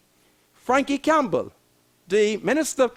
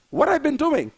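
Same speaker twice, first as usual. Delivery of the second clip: ordinary talk, nothing marked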